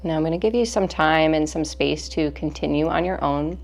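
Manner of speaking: measured breathing cues, stretched out with long pauses; guided meditation. Real, not acted.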